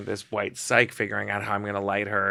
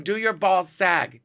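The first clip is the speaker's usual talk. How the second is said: slow, insistent repeated question; high, drawn-out mimicked demand; Joan Rivers; 6 semitones higher, slower than usual. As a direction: spell it out slowly as the impatient comedian. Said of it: "I asked you a question".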